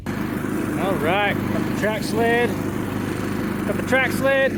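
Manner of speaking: calling out over the wind; hyped, rising shout; excited. Real, not acted. Excited on the sled.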